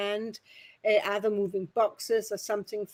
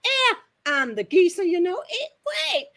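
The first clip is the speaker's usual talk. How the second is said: high, loud Cockney geezer; 9 semitones higher, 7 dB louder than usual. Retold or imitated. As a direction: play the Cockney geezer, pitched right up. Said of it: "his cockney slangs".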